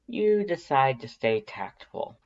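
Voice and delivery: ordinary delivery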